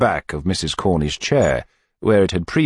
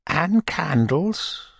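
coy widow; 10 semitones higher, much slower than usual. A narrator's reading. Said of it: "Widow Corney's voice".

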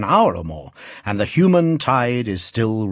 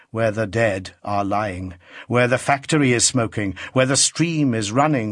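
ordinary delivery, nothing marked

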